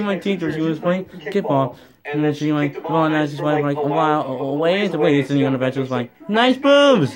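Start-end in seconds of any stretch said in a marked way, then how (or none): 6.3-7.1 retold shout. Kid shouts at teacher.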